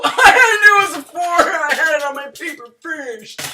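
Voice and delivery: loud, high show-off gloat; flexing. Acted. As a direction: brag loudly to flex. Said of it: "If the purpose was to flex".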